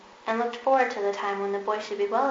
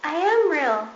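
insisting; 6 semitones higher, 6 dB louder, slower than usual. A narrator's reading. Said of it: "said the little rabbit".

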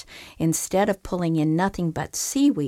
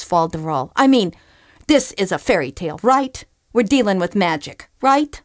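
indignant fisherman; 2 semitones higher, 5 dB louder than usual. Acted. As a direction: argue that the fairy tale goes differently. Fisherman protests the story.

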